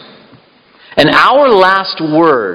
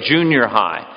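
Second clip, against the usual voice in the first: ordinary talk, nothing marked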